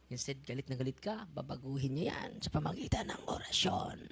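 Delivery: hushed, secretive whisper. Acted. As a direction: let it drop to a breath like a secret. Whispering about secret spells. (pabulong na parang lihim)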